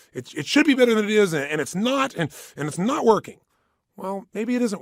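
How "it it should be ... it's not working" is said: frustrated. Acted. Voicing own frustrated self-talk.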